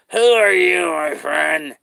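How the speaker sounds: creepy clown raising his voice. Acted. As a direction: push the same question harder as the clown. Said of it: "I'm a clown".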